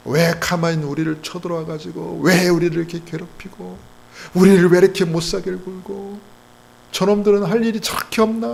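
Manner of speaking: acted whiny protest, rising in pitch; mock grumbling. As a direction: grumble like an aggrieved victim. Voices people grumbling at invaders.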